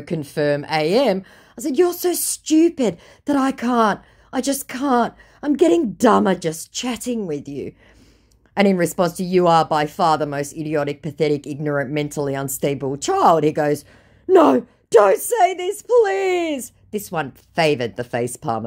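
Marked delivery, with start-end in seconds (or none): 1.8-7.7 high, loud despairing put-down; exasperated. Retold or imitated. Woman giving up on the scammer, exasperated. 14.3-16.7 high, loud, drawn-out wail; mock pleading. Scammer begging her to stop insulting him, mock pleading.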